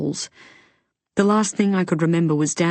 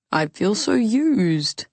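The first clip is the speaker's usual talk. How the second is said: slow, hammed-up hurt; teasing fake self-pity, drawn out; mock wounded; 4 semitones higher, much slower than usual. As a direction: pretend to be a used, heartbroken lover. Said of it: "It was all a big joke to him".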